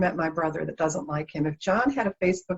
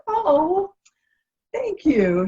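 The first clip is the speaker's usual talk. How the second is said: surprised; 11 semitones higher, 3 dB louder, much slower than usual. Retold or imitated. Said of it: "And she's like".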